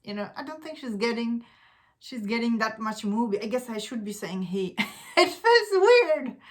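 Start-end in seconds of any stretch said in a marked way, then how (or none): 5.2-6.4 real laugh running into the words, higher and slower. Real, not acted. Vlogger corrects a pronoun about an actor, laughing. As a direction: talk through an awkward laugh.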